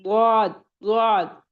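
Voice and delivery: द्वाद from the side of the tongue, heavy and full; ḍād demo. Shown as a demonstration. Teacher models the hardest letter for students. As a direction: press the tongue's edge for द्वाद. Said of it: "तो ये कैसे हो जाएगा?".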